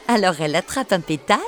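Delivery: usual speaking voice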